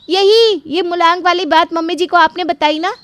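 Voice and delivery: high, loud, insistent; angry accusation. Acted. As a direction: press the charge against the sister-in-law. Radha accuses her sister-in-law. (गुस्से भरी ऊँची आवाज़ में आरोप)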